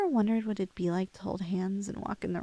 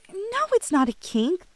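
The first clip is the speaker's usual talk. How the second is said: flustered denial; 9 semitones higher, 7 dB louder than usual. Acted.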